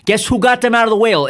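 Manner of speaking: loud insistence. Real, not acted. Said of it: "repeated louder for emphasis".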